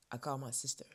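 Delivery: usual speaking voice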